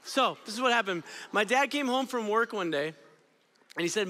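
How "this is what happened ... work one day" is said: usual speaking voice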